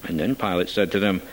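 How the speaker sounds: ordinary delivery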